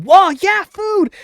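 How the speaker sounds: high, delighted yelp; a spectator squealing as chili dogs fly at them; excited crowd. Acted. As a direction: cheer for free food. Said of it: "you hear them".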